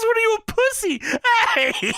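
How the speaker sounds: retelling a wrestler's taunt in a silly high voice; mocking taunt. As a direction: jeer it in a squeaky put-on voice. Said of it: "He says".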